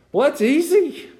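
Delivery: very high, slow squeak. Real, not acted. Preacher whose voice squeaks mid-sermon, squeaky and high. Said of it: "my voice is squeaking this morning".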